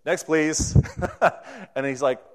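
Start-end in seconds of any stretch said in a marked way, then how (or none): 0.1-0.7 ticket teller. Retold or imitated. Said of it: "the ticket teller says".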